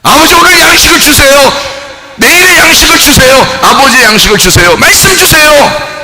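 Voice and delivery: high, loud prayer cry; loud pleading prayer. Retold or imitated. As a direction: call out to the Father in prayer. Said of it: "아빠한테 기도한다고".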